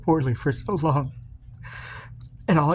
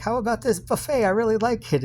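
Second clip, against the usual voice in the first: higher-pitched mock partner voice; playful higher impression of the partner; imitating partner; 9 semitones higher than usual. Speaker imitating partner's buffet request, higher-pitched. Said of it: "And then you're like".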